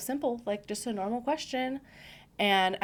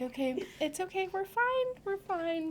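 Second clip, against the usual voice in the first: much higher, strained fake-cheery reassurance; forced cheer; 12 semitones higher than usual. Widow at doctor visit, higher forced-cheery reassurance. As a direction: squeak out forced brightness to hold it together. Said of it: "I'm like".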